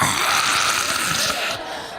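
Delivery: long, noisy breath out; exaggerated toke exhale; huge exhale. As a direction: blow out a giant lungful of smoke.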